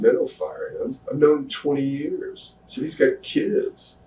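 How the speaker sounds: high, soft, earnest plea. Retold or imitated. Begging the boss not to fire his friend, high and soft. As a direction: quietly beg the boss to spare him. Pleading for attacker's job.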